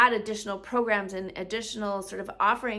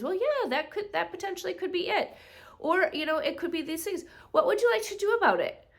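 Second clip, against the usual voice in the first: much higher, put-on doctor voice; imitated doctor; 10 semitones higher than usual. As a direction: play a noncommittal doctor, pitched way up. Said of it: "And the doctor says".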